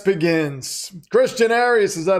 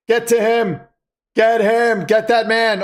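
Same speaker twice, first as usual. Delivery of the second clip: higher, louder shouts at the defense; yelling at TV; 5 semitones higher, 4 dB louder than usual. Real, not acted. Fan watching the Super Bowl yells at the defenders, loud and real. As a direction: urge the pass rush on with a real yell.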